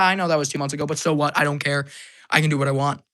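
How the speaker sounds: ordinary delivery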